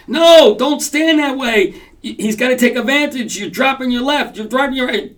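high, loud urgent coach yelling; corner coach. Retold or imitated. Coach on the side yelling at a fighter under pressure, urgent shouting. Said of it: "there's the coach on the side saying".

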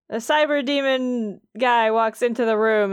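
normal talking voice

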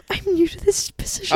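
timid new employee, laughing nervously; scared. Pretend. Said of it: "Why do you sound so scared?".

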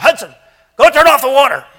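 put-on high, loud bossy little boy; bossy child shout. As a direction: yell it like a bossy kid. Child bossing older brother.

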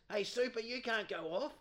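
high, loud call to stay out; excited umpire. Retold or imitated. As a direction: shout him back onto the field. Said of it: "He goes".

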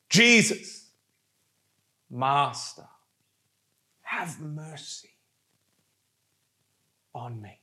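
a prayer cried out in slow pieces, fading; passionate cry. Acted. Preacher acting the lepers' plea to Jesus, passionate cry dying away. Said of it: "cried out with a loud cry".

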